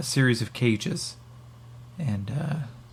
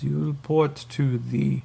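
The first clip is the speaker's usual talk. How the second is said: put-on higher, slow Russian-accented order; 4 semitones higher, slower than usual. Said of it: "the Alterans are now Russian".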